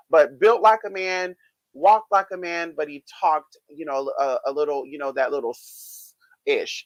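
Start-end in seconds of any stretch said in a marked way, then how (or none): 5.5-6.8 imitating his lisp. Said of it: "I'm gonna call it gayism".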